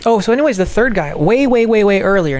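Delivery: loud, high, hurried babble; loud sudden burst. Real, not acted. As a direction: blurt it out loudly.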